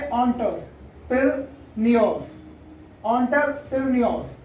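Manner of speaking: एंटरप्रेन्योर slowly split as on-ter-pir-nyor; British एंटरप्रेन्योर with an "on" start, in pieces; British pronunciation. Shown as a demonstration. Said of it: "इसको British में बोलते हैं".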